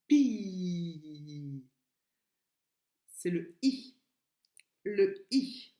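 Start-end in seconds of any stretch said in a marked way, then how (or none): 0.1-1.6 "Pi" with a very long smiled i; syllabe souriante. Shown as a demonstration. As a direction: hold the vowel of "Pi" through a wide smile. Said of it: "je souris". (« Pi » au i longuement tenu, en souriant)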